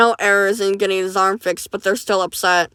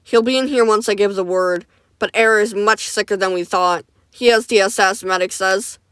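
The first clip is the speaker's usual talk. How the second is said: ordinary delivery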